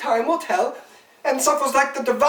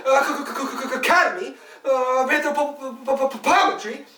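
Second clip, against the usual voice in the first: stuck on the first consonant, repeating it rapidly; stammering. Acted. Lecturer naming the Academy of Anthropometry, stammering. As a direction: stutter into the big learned word. Stammering within the absurd monologue.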